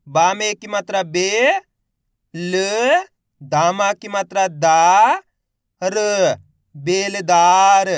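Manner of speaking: मज़ेदार stretched very slowly and loudly; syllable by syllable demo. Shown as a demonstration. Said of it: "मेरे साथ साथ इनको repeat करना है". (अक्षर-अक्षर जोड़कर धीमे पढ़ना)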